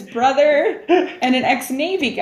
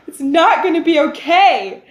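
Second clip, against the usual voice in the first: mock crying; 6 semitones higher, 4 dB louder than usual. Voicing crying friend again.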